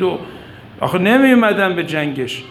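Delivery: ordinary delivery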